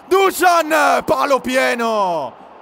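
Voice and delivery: sudden loud cry of a near goal; excited shout. Real, not acted. Near miss hits the post.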